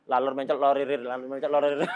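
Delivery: tongue twister rattled off twice without a break; non-stop tongue twister. Shown as a demonstration. Teacher daring students to repeat it five times, non-stop. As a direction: run the words together, no stopping. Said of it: "Jangan berhenti".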